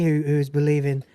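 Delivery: ordinary talk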